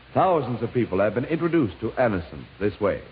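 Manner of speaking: ordinary talk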